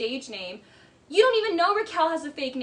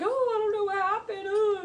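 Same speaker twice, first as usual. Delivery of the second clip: high, whiny mock helplessness; quick, pitched-up clueless act; acting dumb; 8 semitones higher than usual. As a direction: imitate him playing dumb. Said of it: "Like, he acts dumb".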